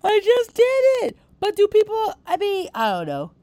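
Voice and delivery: high and loud, laughing protest; loud giddy exclamation; excited outburst. Real, not acted. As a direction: blurt it out, worked up. Excited yawn complaint, loud.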